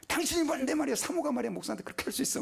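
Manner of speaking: shouting pastor, pitch jumping high; mock yell. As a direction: bark at the wife in hammed-up outrage. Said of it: "소리를 빽 지르고".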